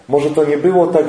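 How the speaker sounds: usual speaking voice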